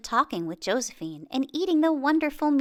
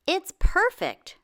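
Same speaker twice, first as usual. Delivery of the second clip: higher, louder, slow and pleased; proud; 8 semitones higher, 4 dB louder, slower than usual. Acted. Earl admiring his new bandage strip, proud. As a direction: savor it like a proud inventor. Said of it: "Earl said proudly".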